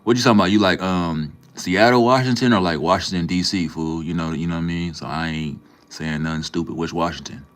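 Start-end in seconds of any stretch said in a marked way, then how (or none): 0.0-4.0 incredulous. Real, not acted. Streamer asking chat which Washington, loud incredulous. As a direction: demand which one they mean.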